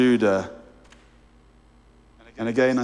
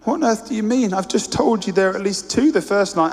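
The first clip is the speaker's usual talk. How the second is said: put-on surprised boy; 8 semitones higher, 3 dB louder than usual. A narrator's reading. The boy protests.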